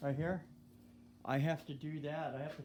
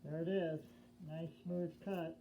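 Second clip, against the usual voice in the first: muffled behind mask; 3 semitones higher than usual. Real, not acted. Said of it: "Let me get this mask off".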